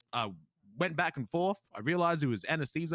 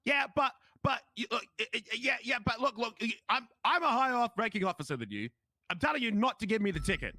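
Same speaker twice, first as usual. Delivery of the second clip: flustered officer; 6 semitones higher than usual. Retold or imitated. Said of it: "She's like".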